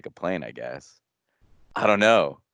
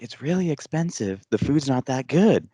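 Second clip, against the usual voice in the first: raised pitch, retold insistence; repeating for dad; 6 semitones higher than usual. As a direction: say it over for someone hard of hearing. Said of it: "And then I finally scream it".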